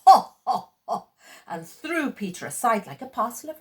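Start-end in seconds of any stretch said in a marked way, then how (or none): 0.1-1.1 loud breathy ho ho ho; a mocking giant's laugh; Saracen's laugh. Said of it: "the Saracen only laughed".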